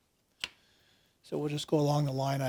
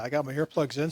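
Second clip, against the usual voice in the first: ordinary delivery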